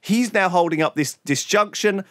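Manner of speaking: normal talking voice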